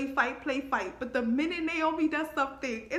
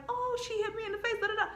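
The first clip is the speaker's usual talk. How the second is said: mocking whine; 11 semitones higher, faster than usual. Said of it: "you over here like".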